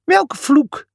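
high scoffing question; mock the idea; scornful. A narrator's reading. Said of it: "schramperde hij".

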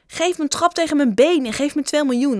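put-on high, loud eager boy; boy pitching a deal. A narrator's reading. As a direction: push the offer on him. Jongen doet brutaal voorstel. (gretige jongen, hoog en hard)

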